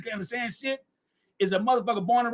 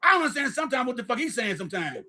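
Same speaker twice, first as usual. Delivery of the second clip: normal talking voice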